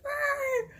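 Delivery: high mock roar of the speeding driver; a quick hammed-up growl as he drives off; imitated angry roar.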